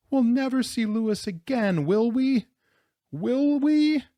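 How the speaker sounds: high, wailing plea; acted wail, pitched far up; tearful sobbing. A narrator's reading. Rosarita crying for Louis in the snow, sobbing. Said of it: "she sobbed".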